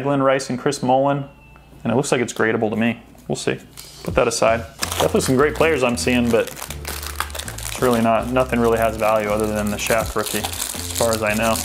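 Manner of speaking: normal talking voice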